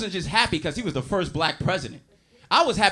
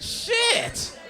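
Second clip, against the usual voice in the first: high, shocked shout; a high startled shout of disbelief; shocked yell. Retold or imitated. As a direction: blurt it out, aghast. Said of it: "I said".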